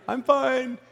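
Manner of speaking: comically high little reply; high-pitched reassurance. Retold or imitated. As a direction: squeak it out as a mock all-clear. His reply from under hot tub.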